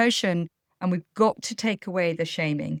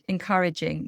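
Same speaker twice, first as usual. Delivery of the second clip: usual speaking voice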